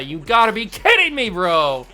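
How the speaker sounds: exasperated outburst; exasperated disbelief. Real, not acted. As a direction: protest the double play in disbelief. Double play ends the hope.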